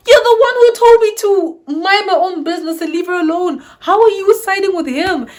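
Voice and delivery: loud, high-pitched protest; retold indignant outburst. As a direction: confront her in high, loud disbelief. Outburst at traitorous house parent.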